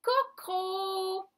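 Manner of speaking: syllables joined slowly. Shown as a demonstration. Teacher testing swapped syllables with pupils, cocro high and drawn out. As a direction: blend co and cro, drawing out the end. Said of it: "et on les met ensemble".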